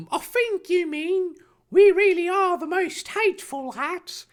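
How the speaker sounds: very high put-on cartoon voice; clever correction. Harry correcting Draco about his house, high put-on voice. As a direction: correct smartly in a silly high pitch. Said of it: "Harry collected cleverly".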